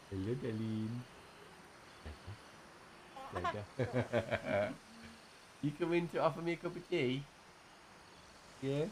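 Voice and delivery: soft, high cooing voice; baby talk. Acted. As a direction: coo softly to a little one.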